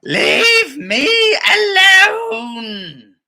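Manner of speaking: high, loud fed-up wail; grandma yelling back, near a cry; hammed-up frustrated shout. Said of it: "she shouted back".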